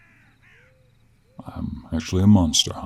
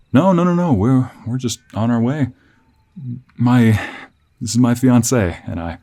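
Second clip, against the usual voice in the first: higher, hesitant traveling fiancé; flustered traveler; 6 semitones higher, 6 dB louder than usual. Acted. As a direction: stammer politely through an explanation to a stranger. Voices a nervous traveler, Dominic.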